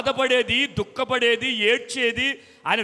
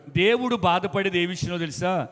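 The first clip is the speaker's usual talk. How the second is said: ordinary talk, nothing marked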